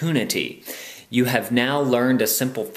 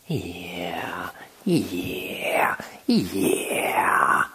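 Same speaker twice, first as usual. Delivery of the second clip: whispered cooing; 7 semitones lower, much slower than usual. Real, not acted. Owner cooing to her cat, whispered. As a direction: breathe the words at the cat.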